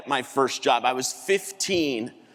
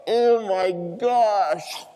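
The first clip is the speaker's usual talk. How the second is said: drawn-out, higher mock cry; retold scream; 4 semitones higher, much slower than usual. Customer knocked to her knees, mock scream. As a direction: stretch it out slowly as an imitated scream. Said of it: "And she screamed".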